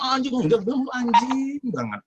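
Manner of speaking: high, gentle curse said as if kindly; mock-polite insult. To the friend claiming he is angry, high mock-gentle cursing. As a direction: sweetly call him a dog. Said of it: "Halus kan?".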